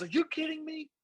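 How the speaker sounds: mock exasperated yell, pitched high; frustrated speaker snaps at a bored crowd; angry outburst. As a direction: slam it out like he's lost it. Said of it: "He gets mad".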